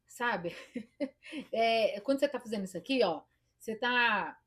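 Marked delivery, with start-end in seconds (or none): none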